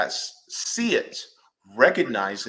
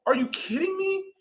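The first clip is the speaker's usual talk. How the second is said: very high disbelief; can't believe it; imitated high-pitched disbelief; incredulous. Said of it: "you're just like".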